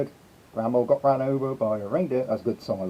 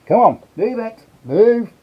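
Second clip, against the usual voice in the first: higher, louder hurry-up; impatient urging; 8 semitones higher, 6 dB louder, slower than usual. Real, not acted. Streamer hurrying the reindeer out, impatient and loud. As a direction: push him out the door.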